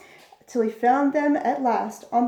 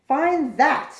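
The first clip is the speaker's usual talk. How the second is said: louder, mocking sneer; 4 semitones higher, 5 dB louder than usual. A narrator's reading. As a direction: taunt the elephant with scorn. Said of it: "sneered the bird".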